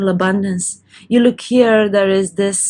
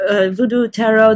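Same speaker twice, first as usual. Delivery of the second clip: ordinary delivery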